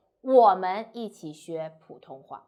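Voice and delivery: 我们 loud up front, rest lighter; stress on 我们. Shown as a demonstration. Teacher saying we, not them, stress on 我们. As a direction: punch 我们 first, then ease off. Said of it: "And if you emphasize 我们".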